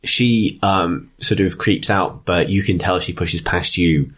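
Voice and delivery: ordinary talk, nothing marked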